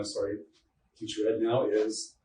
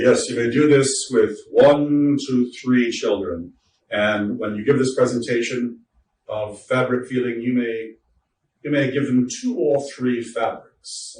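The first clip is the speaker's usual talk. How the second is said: put-on a trainer's slow, louder English accent; mock English accent; 8 dB louder than usual. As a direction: play the stuffy English trainer, drawn out slowly. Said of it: "I'm not sure where the English accent came from".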